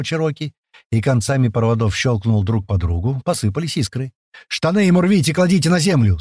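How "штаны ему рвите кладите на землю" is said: higher, urgent command; yelled orders. Acted. Senior man orders the men around Паштет, yelling. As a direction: drive the men on with shouted orders. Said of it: "снова орёт старший".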